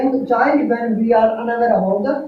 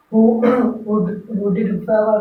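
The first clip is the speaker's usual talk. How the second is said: ordinary delivery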